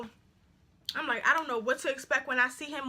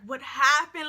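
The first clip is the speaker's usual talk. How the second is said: louder, slow, baffled reaction; retold disbelief; 5 dB louder, slower than usual. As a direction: ask it aloud, drawn out, in mock shock. Retold shock at the scene.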